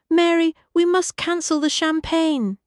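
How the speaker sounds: high, loud, firm upper-class command; lady's order. A narrator's reading. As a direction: stress on "we" as she decides for everyone. Lady orders cancelling champagne.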